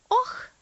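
high loud cry of alarm; a sharp frightened oh; exclaimed oh. A narrator's reading. Mary gasps out in alarm at the story. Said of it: "воскликнула Мэри".

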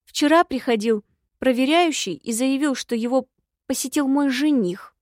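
ordinary delivery

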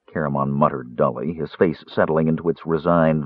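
ordinary delivery

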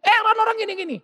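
a wife riled up and venting; mock agitated wife fussing; agitated complaint. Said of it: "dia yang ribut-ribut".

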